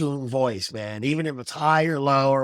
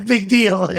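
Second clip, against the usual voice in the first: laughing, louder and higher; amused echo; 5 semitones higher, 5 dB louder than usual. Retold or imitated. Co-host laughing as he repeats the film's opening line, amused and loud.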